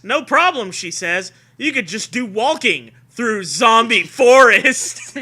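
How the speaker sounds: loud, high spooky promo read; hammed-up blurb. Host reads the back cover as a hammed-up promo. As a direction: oversell it like a cheesy promo.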